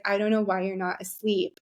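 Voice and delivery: normal talking voice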